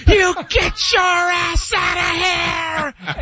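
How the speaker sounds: very high, drawn-out mock yell; hammed-up strained dad holler; imitating dad blowing a gasket. Dad yelling at the dog, hammed-up drawn-out fury. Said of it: "he would just blow a gasket".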